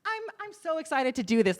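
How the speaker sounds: high, bright mock enthusiasm; fake excitement. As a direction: chirp it with forced excitement. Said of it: "for yourself to be like".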